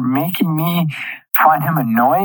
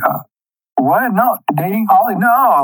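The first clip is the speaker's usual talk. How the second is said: high, flustered mock Michael; flustered denial; 6 semitones higher than usual. Michael dodging the Holly question, high flustered denial. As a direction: play caught-out nervous denial. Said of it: "He was like".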